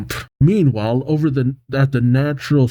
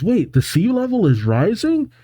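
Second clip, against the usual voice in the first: high-pitched surprised question; 8 semitones higher than usual. Acted. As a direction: lift the pitch in mild disbelief. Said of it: "Gabby questions".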